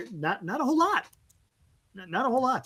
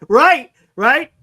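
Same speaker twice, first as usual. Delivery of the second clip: loud, raised-pitch agreement through laughter; laughing; 4 semitones higher, 10 dB louder than usual. Real, not acted. Host amused by a weak batting average, laughing agreement. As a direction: laugh the words out loudly.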